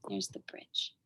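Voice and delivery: soft, low whisper; whispered aside. Acted. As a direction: lean in and whisper it. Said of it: "aside to listener".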